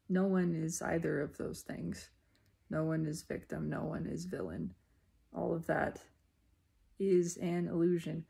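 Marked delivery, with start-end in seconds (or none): none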